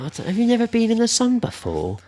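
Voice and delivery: high, put-on tipsy voice; drunk woman voice. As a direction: tease him in a high drunk voice. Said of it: "He's like".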